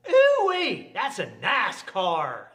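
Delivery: high holler, then drawn-out words; whooping backwoods buddy. Acted. Backwoods buddy admires a mechanic's car, whooping. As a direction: hoot it loud in amazement, slowly savoring the name.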